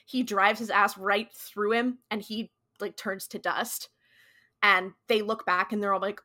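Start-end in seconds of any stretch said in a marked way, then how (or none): none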